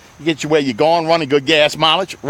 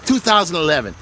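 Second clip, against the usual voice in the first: ordinary delivery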